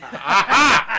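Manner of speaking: loud, high laughing "aha"; laughing exclamation. Real, not acted. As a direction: burst out laughing in triumph. Laughing triumphant outburst over cake.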